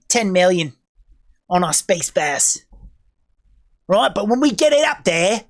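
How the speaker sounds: broad mock Aussie boasting; Australian accent. Aussie space force bragging about its budget, cocky Australian accent. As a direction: brag and threaten in a put-on Australian accent. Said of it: "My, my Australian accent".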